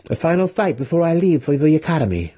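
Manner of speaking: high, quick, eager student; lighthearted, laughing manner. A narrator's reading. Student challenging his mentor before leaving, lighthearted. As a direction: toss it out high and fast, playfully. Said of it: "he, uh, laughed".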